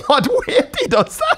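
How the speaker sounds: high, laughing through the question; laughing disbelief. Real, not acted. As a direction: laugh out the surprise.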